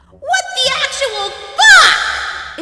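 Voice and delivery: screaming. Acted. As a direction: scream it in disbelief. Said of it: "Sandy in her mind screams".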